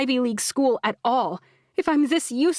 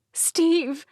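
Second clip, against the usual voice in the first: raw, hoarse call; mother calling for help, voice ragged; 8 semitones higher than usual. A narrator's reading. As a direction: cry out with a ragged, worn voice. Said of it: "My voice is ragged".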